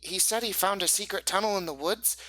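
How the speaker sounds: put-on high, excited kid voice; high-pitched child telling a tale; eager boy's voice. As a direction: share a big secret like an eager boy. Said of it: "out of character".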